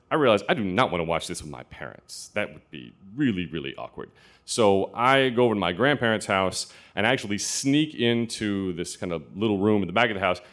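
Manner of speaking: ordinary delivery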